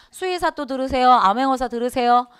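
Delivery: ordinary talk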